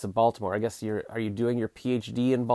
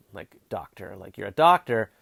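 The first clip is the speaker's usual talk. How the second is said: ordinary talk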